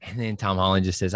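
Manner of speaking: normal talking voice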